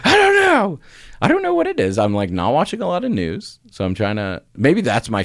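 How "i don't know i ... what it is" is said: much higher, strained and exasperated. Retold or imitated. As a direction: throw it up high in helpless frustration. Exasperated about her anger.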